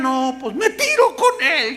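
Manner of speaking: high, drawn-out mock lament; hammed-up wailing. Mourner threatens to jump into the grave, hammed-up wail. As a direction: overdo the graveside hysterics.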